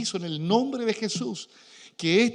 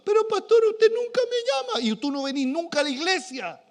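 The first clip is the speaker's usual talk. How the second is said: mock complaint; 11 semitones higher, 4 dB louder than usual.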